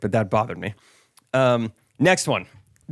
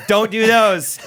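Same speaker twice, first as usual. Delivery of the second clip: higher, louder scold; mock scolding; 9 semitones higher, 5 dB louder, slower than usual. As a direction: forbid it like a strict rule-keeper. Mock list of forbidden things.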